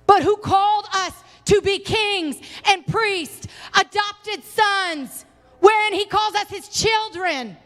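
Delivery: high, drawn-out pulpit proclamation; impassioned preaching. Real, not acted. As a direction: proclaim it high, stretching the key words.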